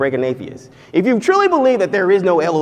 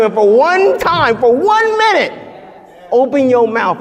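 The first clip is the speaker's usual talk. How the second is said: high soaring plea; 8 semitones higher, 3 dB louder than usual. Acted. God pleading with his people to glorify him, soaring high. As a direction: pitch climbing high and loud. God's plea, rising climax.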